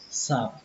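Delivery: "sạp" said alone, soft and stressed; first syllable demo. Shown as a demonstration. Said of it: "âm đầu tiên là âm sạp".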